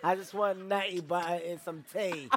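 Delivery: laughing mock English accent, slowing down; awful English accent. Shown as a demonstration. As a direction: savour each food in a bad English accent. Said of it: "My English accent?".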